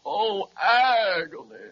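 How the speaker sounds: slow, drawn-out theatrical moan; hammed-up groan of agony. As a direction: wallow in self-pity. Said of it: "stop your infernal moaning".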